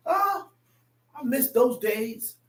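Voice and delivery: normal talking voice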